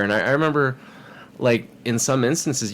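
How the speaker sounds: ordinary talk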